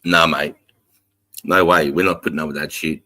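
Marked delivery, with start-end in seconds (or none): none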